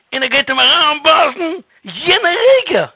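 high, loud and urgent storytelling; dramatic climax. A narrator's reading. Storyteller at the moment the snake strikes, urgent climax. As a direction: build to a high, loud peak of danger.

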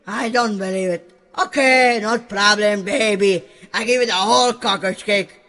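ordinary talk, nothing marked

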